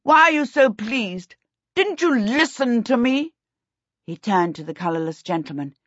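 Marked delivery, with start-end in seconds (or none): 0.0-3.3 high-pitched, exasperated questions; disgusted. A narrator's reading. Prince annoyed that the king looks pleased, high disgust. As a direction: complain in high, affronted disgust. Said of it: "Prince Ludovic said disgustedly".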